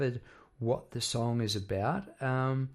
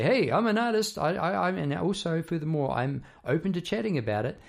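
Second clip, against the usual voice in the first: mock announcement; 6 semitones higher, 4 dB louder than usual. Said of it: "we hold up a sign and say".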